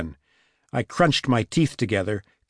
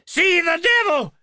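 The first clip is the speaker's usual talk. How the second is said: very high, crazed scream; 3 dB louder than usual. A narrator's reading. Frantic woman bolting downstairs at police, high scream. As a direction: shriek it way up high. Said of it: "she screamed".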